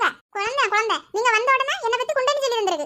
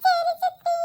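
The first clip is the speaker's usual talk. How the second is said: higher, meek little reply; meek child; 7 semitones higher, much slower than usual. Acted. Child meekly obeys stepmother. (பயந்த குழந்தையின் பதில்)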